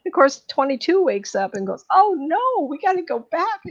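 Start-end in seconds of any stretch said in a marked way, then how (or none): 1.9-3.6 high, loud panicked cry. Retold or imitated. Twenty-two waking in the wrong body, panicked high cry. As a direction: blurt it out in sudden alarm.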